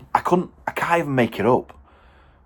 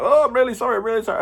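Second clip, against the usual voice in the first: mock apologetic customer; 13 semitones higher, much faster than usual. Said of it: "he was like".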